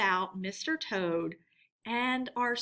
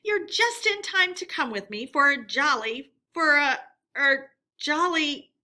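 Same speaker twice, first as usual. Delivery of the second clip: louder, higher, slowing as he falters; faltering Toad; 5 semitones higher, 7 dB louder than usual. Acted. Toad inviting friends, faltering at stern looks. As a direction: enthusiasm dying mid-sentence. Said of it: "Toad's words faltered".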